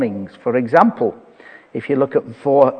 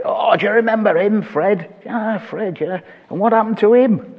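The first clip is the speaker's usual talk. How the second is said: lightly voiced gossip, higher, quick; mock reunion chatter, higher and fast; chatty old classmate; 6 semitones higher than usual. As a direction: play an eager old schoolfriend asking. Acted chat among old classmates.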